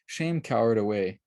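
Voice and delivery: ordinary delivery, nothing marked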